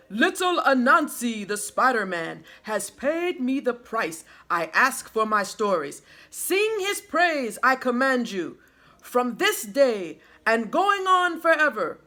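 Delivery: loud, commanding sky god address; a god announcing to his whole court; loud royal proclamation. A narrator's reading. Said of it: "addressed them in a loud voice".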